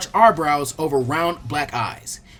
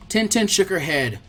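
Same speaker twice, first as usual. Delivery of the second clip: ordinary delivery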